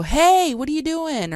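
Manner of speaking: high, loud call down the stairs; Roy's voice yelling down to the basement; husband calling down. Retold or imitated. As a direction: call out like Roy from the top of the stairs. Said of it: "I heard Roy go".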